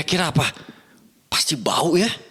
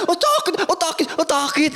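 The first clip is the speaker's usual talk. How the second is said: high, whiny mock moaning; imitated complaint of pain; 10 semitones higher than usual.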